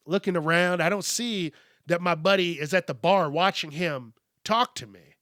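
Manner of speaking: usual speaking voice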